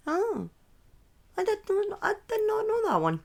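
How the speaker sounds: high, soft surprised exclamation; genuinely taken aback by a chat comment; softly high-pitched real surprise. Real, not acted. Surprised reaction reading chat.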